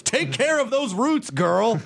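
put-on high sassy girlfriend voice. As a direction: scold her like a sassy bestie. Sassy address to [name].

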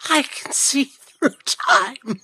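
put-on high, slow, dazed child voice; drawn out like seeing visions; Lisa quote voice. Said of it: "And she says".

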